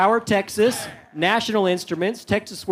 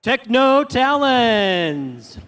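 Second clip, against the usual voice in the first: slow, drawn-out team name; announcer call; 2 semitones higher, much slower than usual. Real, not acted. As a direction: boom the name like a ring announcer.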